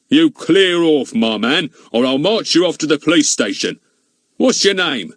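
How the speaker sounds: bully him off; threatening. A narrator's reading. Goon threatening Fatty.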